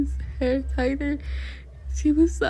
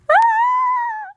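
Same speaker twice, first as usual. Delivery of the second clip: loud high-pitched giggle squeal; shrill burst of laughter; 7 dB louder than usual. Real, not acted. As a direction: squeal with embarrassment. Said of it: "I can't keep my composure".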